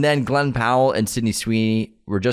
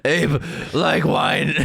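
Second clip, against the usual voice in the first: slow dumb ape voice; 4 semitones higher, much slower than usual. Pretend. Ape voice joke.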